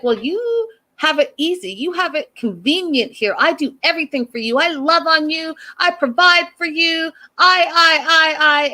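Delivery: high-pitched mimicry of a self-righteous partner; mock nagging. Host mimicking a girlfriend listing all she does, mock nagging.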